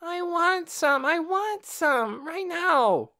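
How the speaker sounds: put-on high, slow whiny child voice; nagging little girl. A narrator's reading. Little girl begging for potatoes, whining. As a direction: drag each want out, pleading.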